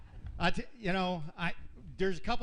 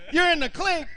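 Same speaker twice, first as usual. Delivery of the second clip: shouted in disbelief, much higher and louder; shocked disbelief; 10 semitones higher, 9 dB louder than usual. Retold or imitated. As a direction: blurt it out, stunned. Retold shock at jail news.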